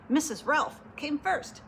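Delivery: usual speaking voice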